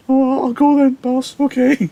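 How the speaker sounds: high, meek servant voice; high squeaky servant's reply. Acted. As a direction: chirp it up high like an eager servant. Servant Balthazar voiced in retelling.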